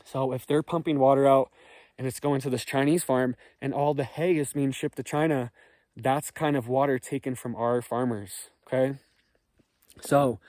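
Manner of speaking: ordinary talk, nothing marked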